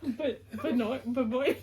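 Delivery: ordinary talk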